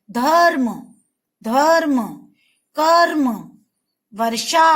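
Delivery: धर्म, कर्म, वर्षा said loud, each with a stressed r; loud slow model of धर्म कर्म वर्षा; emphatic model words. Shown as a demonstration. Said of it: "आप बोलोगे तो ही आपको समझ में आ जाएगा".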